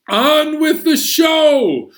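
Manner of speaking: high, loud and drawn-out showman call; excited announcement. Real, not acted. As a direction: announce it like a ringmaster. Announcing the show to kids.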